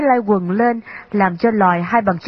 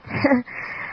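brief, soft breathy chuckle; short laugh; 4 semitones higher, 6 dB quieter than usual. A narrator's reading. Younger brother answering his sister, short laugh. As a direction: chuckle lightly before answering. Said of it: "Công Cẩn cười và đáp".

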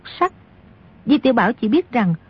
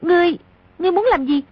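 put-on high-pitched indignant young woman; mildly acted high-pitched challenge; angry demand; 7 semitones higher, much faster than usual. A narrator's reading. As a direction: voice her anger high, without shouting. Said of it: "tức giận quát".

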